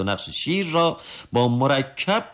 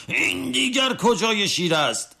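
high whining question through pain; wailing protest; 12 semitones higher than usual. A narrator's reading. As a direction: whine it out, fed up with the needle. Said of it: "فریاد و فغان سر داد".

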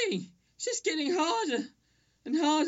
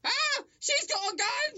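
high, slow terrified cry; panicked scream; 10 semitones higher, slower than usual. Acted. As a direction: panic at the gun. Sudden panic.

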